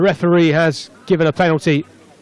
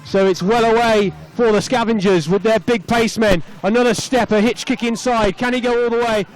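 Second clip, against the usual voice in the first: raised pitch, building as the attack breaks away; excited play-by-play; 5 semitones higher than usual. Real, not acted. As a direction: call the breakaway try live, voice lifted. Live play-by-play of try.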